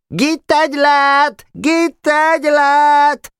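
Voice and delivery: shrill, drawn-out high yell; long squawking chant; screeching shout. A narrator's reading. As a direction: screech it like a heckling boy. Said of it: "rikácsoló hangon kiabált".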